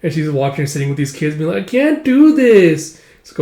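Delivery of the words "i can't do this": put-on high, slow whine of a kid; whiny child. Recapper voicing a child who gives up on sewing, whining. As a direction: drag it out like a frustrated little kid.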